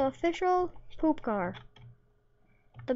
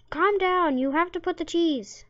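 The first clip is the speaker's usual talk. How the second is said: exasperated yelling; 4 semitones higher than usual. Real, not acted. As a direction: shout instructions at the silly NPC. Yelling at game character.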